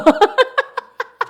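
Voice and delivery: high-pitched giggle; high laugh. Real, not acted. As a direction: break into laughter. Laughs after husband walked off.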